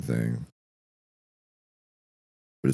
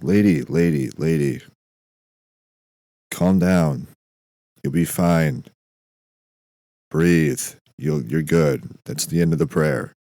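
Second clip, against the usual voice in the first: exasperated calming; 4 semitones higher, 5 dB louder than usual. Acted. God settles a frantic praying woman.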